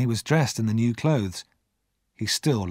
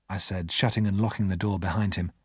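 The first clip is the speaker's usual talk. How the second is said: plain reading voice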